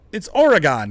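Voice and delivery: "Oregon" with a clear "gone" at the end; correct Oregon. Shown as a demonstration. Streamer correcting chat on the "Oregon" ending. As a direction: stress "Oregon" as "Ore-GONE". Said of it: "I don't say Gendola. I say Gondola".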